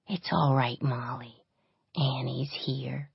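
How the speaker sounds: soft, slow whisper; gentle whisper. A narrator's reading. Annie comforts the crying child, soft slow whisper. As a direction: hush her gently back to calm. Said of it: "gently stroking".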